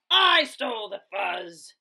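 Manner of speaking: loud bully gloat. Acted. Big Bark gloating from above, loud. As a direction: boast it down at them.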